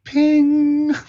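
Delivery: high submarine sonar ping. Retold or imitated. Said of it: "make this sound ... submarine sound".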